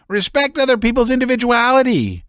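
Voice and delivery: high, fast, cutting in; Laevsky cuts him off, rattled; agitated interruption. A narrator's reading. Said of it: "interrupted Laevsky".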